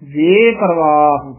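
ਵੇ held long, leading into ਪਰਵਾਹ; drawn-out ਵੇ. Shown as a demonstration. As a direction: stretch ਵੇ slowly without breaking ਵੇ ਪਰਵਾਹ. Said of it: "ਲਮਕਾ ਕੇ ਉਚਾਰਨ ਕਰਨਾ ਹੈ".

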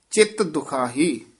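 usual speaking voice

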